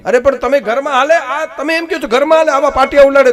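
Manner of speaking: high, loud disbelief; can't believe what he's hearing; astonished protest. Retold or imitated. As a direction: friend protesting in disbelief about the thrown plank.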